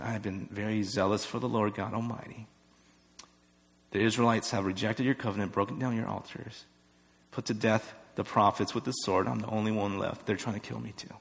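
soft, low, drained of force; subdued reply. Acted. Elijah answers the whispering God again, subdued. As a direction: answer quietly, voice held down.

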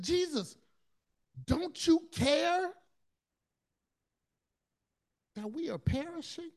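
high, pleading cry to Jesus; desperate plea. Retold or imitated. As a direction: beg him as if the boat is sinking. Disciples cry out in storm.

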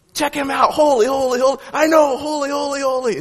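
high, eager angel voices passing it on; excited angels. Acted. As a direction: play the thrilled angels nudging each other. Angels excitedly telling each other.

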